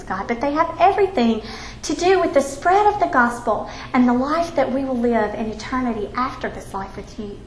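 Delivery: soft, hushed prayer voice; closing prayer. Acted. Teacher closes the ladies' class in prayer, hushed. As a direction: pray it quietly and earnestly.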